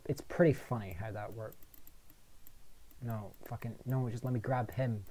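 ordinary talk, nothing marked

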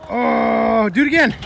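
loud, high cheer; excited shout. Real, not acted. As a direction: hype him up loudly. Cheering skater at skate park.

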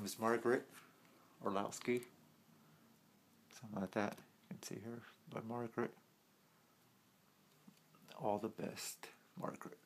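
muffled behind silicone mask; speaking inside rubber mask; through a face mask. Real, not acted. Seen 2: Speaker wears a full silicone face mask throughout, none in R frames.

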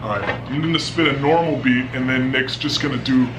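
very low voice from sulfur hexafluoride; deep gas voice. Real, not acted. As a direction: talk through a lungful of heavy gas. SF6 inhaled.

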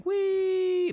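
squealed high wee; a sudden gleeful whoop. Real, not acted. Whooping during gameplay.

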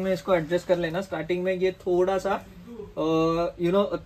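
fabric covering the mouth; slightly muffled mask speech; through a face mask. Real, not acted. Seen 1: Balaclava covers mouth throughout; helmet not worn during these words.

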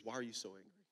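soft, low, tender questions; gentle questioning. Acted. God asking the angry listener, soft and tender questions. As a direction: ask softly, like a caring father. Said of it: "he says".

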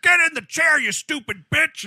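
high, loud acted bark; bully the rider into the seat; bullying yell. Ride attendant to frightened girl, yelling angrily.

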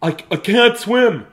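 high, loud stammered cry; acted drowning panic; panicked yell. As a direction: shout it as the water pulls you under. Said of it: "he yelled".

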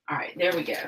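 soft whispered aside to herself. Real, not acted. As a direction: whisper it under your breath. Hushed while working.

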